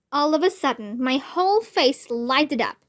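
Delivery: usual speaking voice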